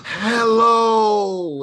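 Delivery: breath-heavy greeting; breathy h. Shown as a demonstration. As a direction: push air through the h of Hello. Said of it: "It's very breathy".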